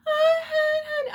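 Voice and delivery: very high, loud, sugary "oh honey"; syrupy Southern mom. Retold or imitated. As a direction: coo it like a fake-sweet Texas mother. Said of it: "she tried to do the".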